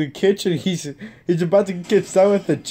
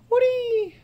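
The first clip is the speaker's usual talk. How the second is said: sing-song call. Real, not acted. Calling the pet dog.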